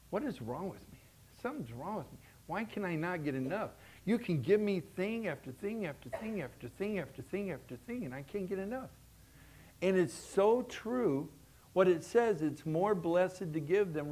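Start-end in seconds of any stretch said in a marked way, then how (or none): 0.1-8.9 much softer, wondering; quiet inner voice. Retold or imitated. Preacher recalling his inner thought, quiet. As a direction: ask yourself quietly what is wrong.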